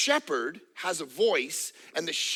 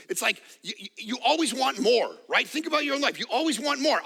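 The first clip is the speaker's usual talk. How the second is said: usual speaking voice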